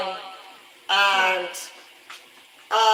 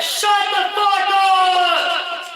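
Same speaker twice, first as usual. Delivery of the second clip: very slow, high, loud drawn-out shout; crew yelling back; 11 semitones higher, 10 dB louder, much slower than usual. Retold or imitated. Crew members yelling back at Jared, long loud high shout. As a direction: bellow it long and high at him.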